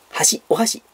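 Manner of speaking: 箸 pitched as chopsticks, then お箸; chopsticks intonation. Shown as a demonstration. Streamer contrasting similar words, chopsticks intonation. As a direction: demonstrate 箸 meaning chopsticks. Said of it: "その辺でイントネーションね".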